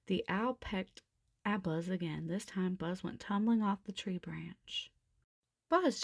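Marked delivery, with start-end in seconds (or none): 5.7-6.0 high, loud cry of his name. Acted. Jessie crying out as Buzz tumbles off the branch. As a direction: call out as he falls. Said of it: "Jessie cried".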